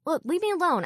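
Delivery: high-pitched, exasperated brush-off; irritated. Acted. Driver wants the detective off the line, irritated. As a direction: push the caller away. Driver snaps, ends the call.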